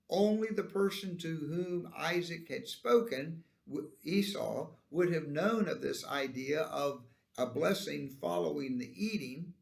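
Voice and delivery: usual speaking voice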